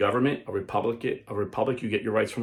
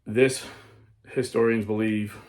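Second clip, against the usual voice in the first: normal talking voice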